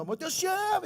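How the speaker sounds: sudden high cry of "Deus te ama"; retold shout. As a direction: call it out high and strong like a worship leader. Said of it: "ele até grita".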